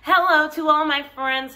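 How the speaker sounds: usual speaking voice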